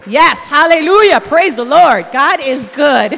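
God is good slow and booming; rousing praise. Real, not acted. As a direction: lift the congregation up. Preacher rousing the congregation.

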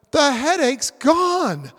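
loud, high, drawn-out astonishment; acted amazed relief. Patient whose headache suddenly vanished, astonished relief. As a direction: exclaim it slowly in big amazement.

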